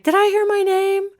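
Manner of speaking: high, sing-out query; retold startled question. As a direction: voice jumps way up, imitating a friend's question. Retold question called out to her.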